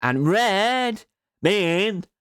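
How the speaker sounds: high, slow, loud yelling. Shown as a demonstration. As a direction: shout it out slowly. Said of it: "Yellow means I'm yelling".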